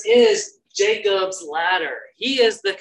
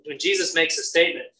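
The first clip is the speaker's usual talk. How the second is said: ordinary talk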